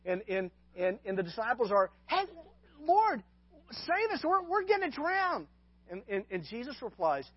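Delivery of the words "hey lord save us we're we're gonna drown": high, frantic plea for rescue; mock terror, high and pleading; panicked cry. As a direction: cry out like you're sinking. Disciples panicking in the storm.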